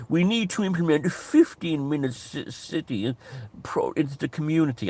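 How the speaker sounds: high, put-on posh English home counties voice; mock home counties English accent. Mocking English incomers at a town meeting, posh English accent. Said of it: "English people with home county accents".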